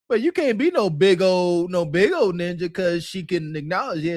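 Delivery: ordinary delivery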